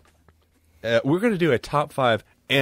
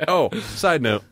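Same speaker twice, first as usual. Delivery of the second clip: ordinary talk, nothing marked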